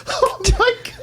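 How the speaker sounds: high, laughing disbelief; laughing exclamation. Retold or imitated. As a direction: laugh it out in shock. Retelling eating very hot sauce.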